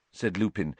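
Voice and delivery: plain reading voice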